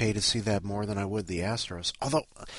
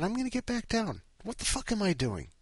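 exasperated self-scolding; 12 semitones higher than usual. Retold or imitated. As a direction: whine at yourself in disbelief. Scolds himself about his weight.

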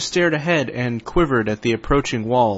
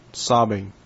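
ordinary talk, nothing marked

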